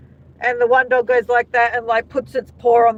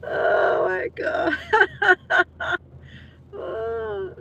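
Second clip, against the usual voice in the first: breathless laugh; 7 semitones higher, 4 dB quieter, much slower than usual. Real, not acted. Cracking up at the comment.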